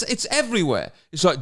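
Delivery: ordinary delivery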